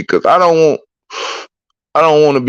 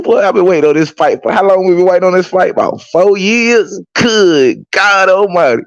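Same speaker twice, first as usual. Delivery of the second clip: excited; 10 semitones higher than usual. Real, not acted. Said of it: "I'm so excited".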